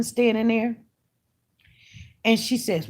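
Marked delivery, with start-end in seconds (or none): none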